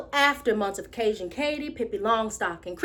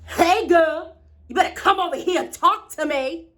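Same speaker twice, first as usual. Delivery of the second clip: put-on loud, higher-pitched call from a pushy boy; cocky boy; 5 semitones higher, 3 dB louder than usual. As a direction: call her over, teasing and bossy. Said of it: "this young old boy jumped out at me and said".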